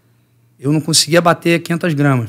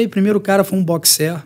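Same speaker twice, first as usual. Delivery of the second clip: ordinary delivery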